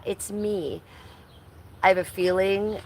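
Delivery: usual speaking voice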